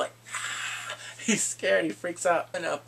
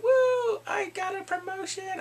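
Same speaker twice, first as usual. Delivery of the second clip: high, gleeful mock cheer; excited celebration; 4 dB louder, slower than usual. Woman celebrating her promotion, excited. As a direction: whoop it up in a high voice.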